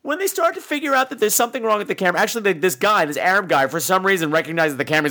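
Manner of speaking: ordinary talk, nothing marked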